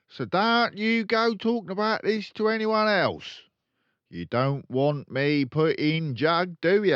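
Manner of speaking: loud, high, brash dealer father; boastful crooked dad. Acted. Father bragging to his kids, loud and brash. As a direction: brag like a swaggering car salesman.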